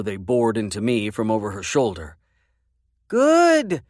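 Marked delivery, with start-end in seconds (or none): 3.1-3.8 put-on very high, loud little-girl shout; a small girl yelling her answer; child shouting. A narrator's reading. Little girl shouting back to grandpa, very high. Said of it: "Bailey shouted".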